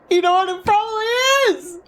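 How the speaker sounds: high, laughing yell; laughing shout. Real, not acted. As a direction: shout it through helpless laughter. Laughing outburst at game twist.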